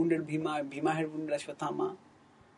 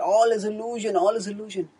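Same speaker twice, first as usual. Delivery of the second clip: despairing cry; 5 semitones higher, 5 dB louder than usual. Retold or imitated. Fleeing warrior's despairing cry.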